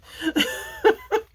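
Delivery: a high, bright laugh that falls away; high burst of laughter. Real, not acted. Crafter laughing at a rude viewer comment, high laugh. Laughs at her own retort.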